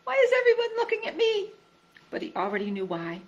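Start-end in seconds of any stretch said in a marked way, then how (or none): 0.0-1.5 nervous Piglet. A narrator's reading. Piglet anxious, everyone staring.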